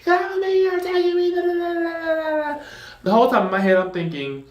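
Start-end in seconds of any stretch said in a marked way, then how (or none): 0.0-2.6 very high, shrill mock screams; hammed-up victim yelling at police; mock screaming. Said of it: "he's screaming".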